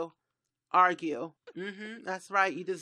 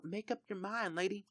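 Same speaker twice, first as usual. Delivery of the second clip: much softer, under-the-breath grumble; muttered aside; 9 dB quieter than usual. Acted. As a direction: mutter it to yourself, resigned. Repeating scold to indecisive Sim.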